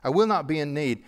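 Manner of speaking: ordinary talk, nothing marked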